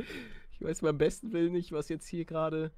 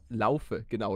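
ordinary delivery

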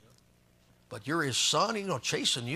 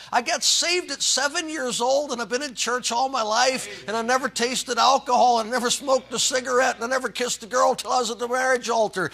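proud testimony; 7 semitones higher, 6 dB louder than usual. Retold or imitated. Voicing proud clean-life testimony.

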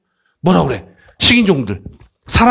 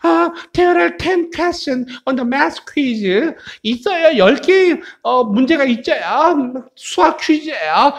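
high-voiced speaker A; 8 semitones higher, much slower than usual. Teacher acting A announcing a math quiz, high character voice. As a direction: pitch it way up for the first character. A가 얘기하죠?.

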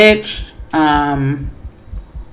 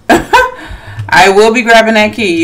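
laughing; 4 semitones higher, 4 dB louder, slower than usual. Real, not acted. Teasing killer while taking key.

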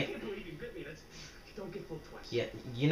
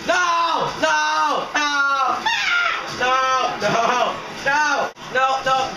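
high, repeated wailing refusals; frantic protest; 8 semitones higher, much slower than usual. Real, not acted. Player losing in the game, frantic protest. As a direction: cry out in dismay over and over.

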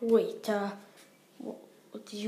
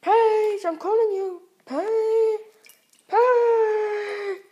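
loud, high yelling of the name; calling out; 8 semitones higher, 6 dB louder, much slower than usual. Pretend. Character yelling for Peach to come, loud high calls. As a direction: shout her name across the house.

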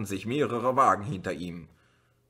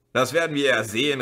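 louder, fast defiant retort; defiant call; 4 semitones higher, 6 dB louder, faster than usual. A narrator's reading. Mercedes driver calling back defiantly at the policeman. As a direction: challenge him as you drive on. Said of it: "rief der Mann".

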